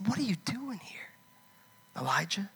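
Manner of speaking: God's question in a whisper; hushed whispered voice of God. Acted. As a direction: whisper it like a still small voice. God's still small voice.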